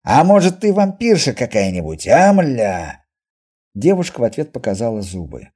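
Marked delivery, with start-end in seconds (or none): 0.0-3.0 loud, jeering exposé; accusing taunt. Acted. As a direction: needle her loudly with suspicion. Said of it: "с видом разоблачителя произнёс Костоправ". (громкая обвинительная издёвка)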